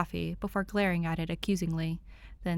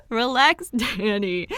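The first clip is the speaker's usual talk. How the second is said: 1 calming laugh; 4 semitones higher, 8 dB louder, slower than usual. A narrator's reading. Dick calming Danny.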